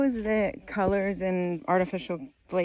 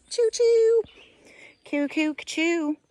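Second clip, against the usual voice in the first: high, drawn-out choo-choo; playful train noises; 11 semitones higher, 6 dB louder, slower than usual. Pretend. Derailed thought turned into a joke, playful high train toots. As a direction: toot like a little train whistle.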